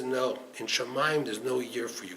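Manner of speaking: low, soft, immovable answer; quiet refusal. Retold or imitated. Angel of death turning down the groom, quietly firm. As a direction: refuse softly and gravely.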